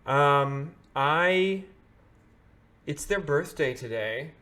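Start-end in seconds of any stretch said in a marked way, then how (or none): none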